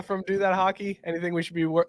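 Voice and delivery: ordinary delivery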